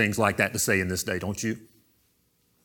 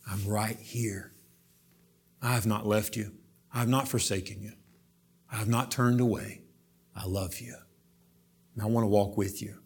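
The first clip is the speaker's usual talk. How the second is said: tender pleading; 6 dB quieter than usual. Acted. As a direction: whisper comfort to someone hurting. Jesus pleading to hurting listener.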